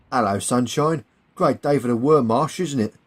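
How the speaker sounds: cheery, brighter greeting to a prisoner; breezy mock-friendly hello. A narrator's reading. Stanley greets the gagged German officer, friendly. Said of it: "says Stanley in a friendly fashion".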